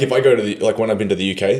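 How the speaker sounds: normal talking voice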